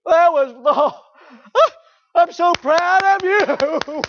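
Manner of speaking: high, loud, slow gushing with a laugh; over-the-top doting parent. Retold or imitated. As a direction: melt into proud-mom rapture. Mocking doting softball parents.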